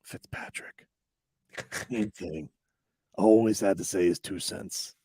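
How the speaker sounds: hushed, sneaky answer; quiet aside naming the culprit; whispered gossip. Retold or imitated.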